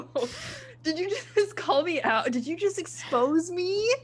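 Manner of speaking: amused, indignant squeal of being called out; mock outrage. Captain exposed by crew member, laughing mock shock.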